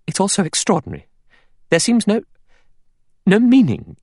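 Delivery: high, agitated bewilderment; distressed. A narrator's reading. Vera distressed at the mystery.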